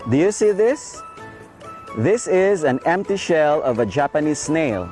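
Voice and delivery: ordinary talk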